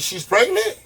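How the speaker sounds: surprised question. Retold or imitated. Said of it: "I said".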